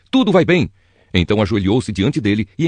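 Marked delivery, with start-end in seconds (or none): 0.1-0.7 shouting. Acted. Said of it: "Aimaas gritou para o rei".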